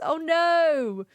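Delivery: ordinary talk, nothing marked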